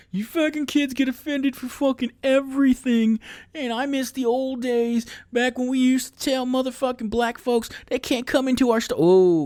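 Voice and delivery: high, loud cranky caricature; hammed-up, high-pitched grumbling bigot; ranting old-timer. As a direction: rant like one of those guys pining for the old days. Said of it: "trying to be one of those guys".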